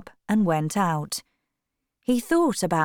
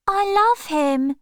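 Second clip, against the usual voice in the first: much higher, louder, slow "I love him"; ardent declaration; 14 semitones higher, 4 dB louder than usual. A narrator's reading. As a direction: declare it to your brother, glowing.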